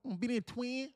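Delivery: high, overdone Atlanta country voice; mock Atlanta accent. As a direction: ham up an Atlanta rapper. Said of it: "I'm talking like [name]".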